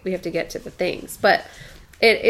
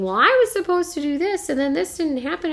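higher mock six-year-old whine, rising; whiny son; 9 semitones higher than usual. Son complaining to mom about his day, higher childish whine. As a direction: whine like a kid whose plans fell through.

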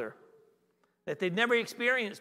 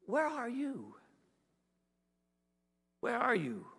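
soft, hushed call to someone lost; God's quiet appeal, voiced gently; tender searching question; 3 semitones higher, 7 dB quieter than usual. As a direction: ask it softly, leaving a long silence.

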